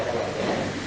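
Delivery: breathy whisper, voice dropped; hushed under-breath exclamation. Shown as a demonstration. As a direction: whisper it so no one hears. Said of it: "você fala".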